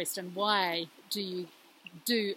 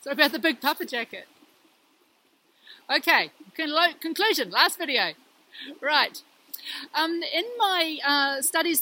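raised voice over running water; beside rushing river; 2 semitones higher than usual. Real, not acted. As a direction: speak up over the stream. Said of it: "hopefully you can hear me over the water".

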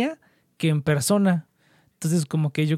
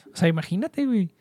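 ordinary talk, nothing marked

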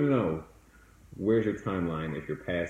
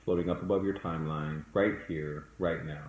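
soft spa whisper; 2 semitones lower, 5 dB quieter than usual. Acted. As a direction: lead them calmly into the past. Leads a guided meditation.

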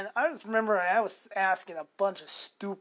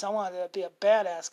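usual speaking voice

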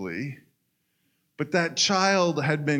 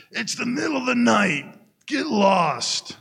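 grumpy neighbour yelling through the door, higher and louder; gruff man; 8 semitones higher, 4 dB louder than usual. Acted. Annoyed neighbour sending Joe away, loud and gruff. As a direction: bark it at the stranger at night. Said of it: "a gruff male voice answered".

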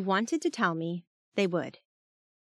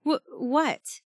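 frightened stammer, higher; shaking; 6 semitones higher than usual. Acted. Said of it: "I was still shaking".

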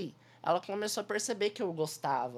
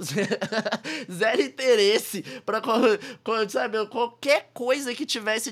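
laughing while talking; 3 semitones higher, 6 dB louder, slower than usual. Real, not acted.

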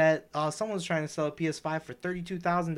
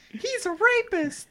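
very high, drawn-out squeal; hammed-up high-pitched outcry; mock accusation; slower than usual. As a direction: squeal the accusation slowly in a comic falsetto. Mock accusation in Harris bit.

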